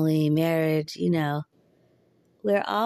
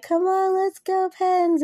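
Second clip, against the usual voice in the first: mock fan yell, pitched way up; high-pitched hockey cheer without full volume; retold yelling; 12 semitones higher, 3 dB louder than usual. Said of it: "yelling at the T V".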